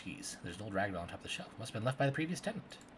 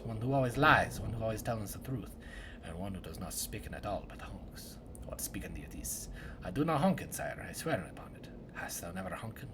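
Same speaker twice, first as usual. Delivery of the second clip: archaic woodcutter drama; 2 semitones lower than usual. Acted. Woodcutter interrogating suspects about the murder, hushed and theatrical. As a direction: play a solemn woodcutter grilling suspects in a murder. Voices woodcutter and tree dialogue.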